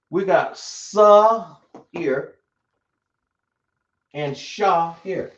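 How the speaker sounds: "sa" with a plain s, then "sha" with sh, slowly; slow contrast sa sha. Shown as a demonstration. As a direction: draw out "sa" and "sha" so the s and sh differ. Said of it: "Y'all see the difference".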